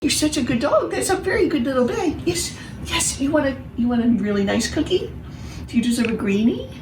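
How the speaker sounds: high sing-song praise for a good dog; doggy talk. Real, not acted. Owner talking to her dog.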